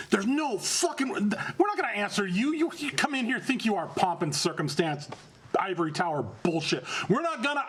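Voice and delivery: high, indignant ranting rebel; angry protest. Acted. Rebel Brujah yelling at the new Prince, high angry ranting. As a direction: throw the challenge in his face.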